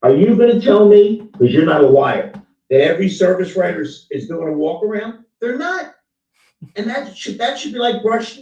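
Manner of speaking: loud, pressing rhetorical challenge; heated challenge. Real, not acted. Challenging a colleague on service writers, loud and pressing.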